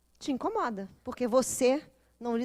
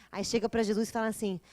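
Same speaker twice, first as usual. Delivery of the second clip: ordinary talk, nothing marked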